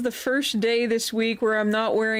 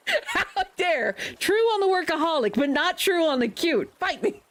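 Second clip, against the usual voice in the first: high, hammed-up indignant protest; mock outrage; 7 semitones higher than usual. Teased streamer protesting to chat in hammed-up mock outrage. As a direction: play at being offended, daring them on.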